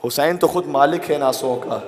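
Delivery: slow, loud weighty preaching; slow emphatic climax. Real, not acted. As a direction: land each word with weight. (آہستہ اور زوردار خطابت)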